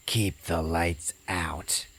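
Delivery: put-on slow, creepy ghost-girl voice; creepy little girl. Ghost girl on the recording, slow and eerie. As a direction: drag it out eerily. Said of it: "you hear this little girl go".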